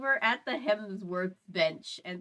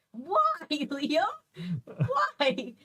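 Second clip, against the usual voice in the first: high, indignant cry of why; mock outrage; 7 semitones higher, slower than usual. Emma protesting Liam stealing mushrooms, high mock outrage. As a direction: playfully accuse the thief, pitched up.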